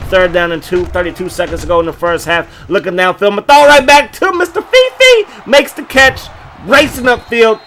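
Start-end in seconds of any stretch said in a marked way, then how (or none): 3.3-7.6 much higher, loud play-by-play burst; hyped announcer call; excited call. Real, not acted. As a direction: sell the big catch and run. Completed pass, racing upfield.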